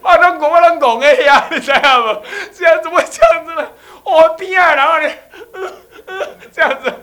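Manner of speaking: high, loud, half laughing half sobbing; laughing and crying. Retold or imitated. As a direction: gush through laughter near tears. Retells himself overwhelmed, laughing crying. (又笑又哭，高声激动)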